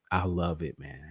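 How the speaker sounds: usual speaking voice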